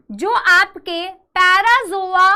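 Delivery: normal talking voice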